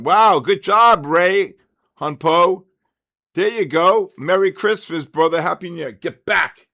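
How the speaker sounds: loud, high, cheerful calls to viewers; excited shout-outs. Real, not acted. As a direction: shout out the chat names with gusto.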